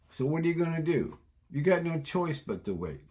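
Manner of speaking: ordinary talk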